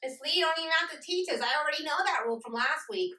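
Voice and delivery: high, fast pupil voice; know-it-all pupil. Acted. Pupil telling Miss Lee they already know the rule, high and fast.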